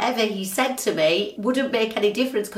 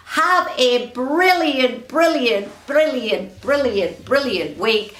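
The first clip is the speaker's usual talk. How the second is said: enthusiastic sign-off; 4 semitones higher, slower than usual. Real, not acted. Wishing listeners a great week, excited then fading. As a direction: build the cheer then let it settle.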